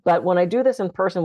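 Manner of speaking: usual speaking voice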